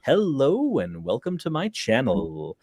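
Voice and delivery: slow radio host voice; slow, smooth radio announcer delivery; Vice Rhino voice. Retold or imitated. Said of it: "as if I'm Vice Rhino".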